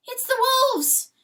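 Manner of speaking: high, loud anguished wail. A narrator's reading. Hen wailing the news of wolves, high anguished cry. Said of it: "she wails".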